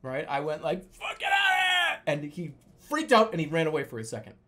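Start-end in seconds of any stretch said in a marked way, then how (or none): 0.9-2.0 high, drawn-out yell; imitated scream. Dreamer screaming at the tiger through the window, high acted yell. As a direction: scream to scare an animal off. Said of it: "and I screamed".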